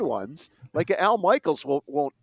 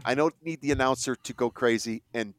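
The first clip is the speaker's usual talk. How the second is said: normal talking voice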